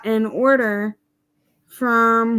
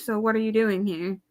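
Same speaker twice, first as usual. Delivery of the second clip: ordinary talk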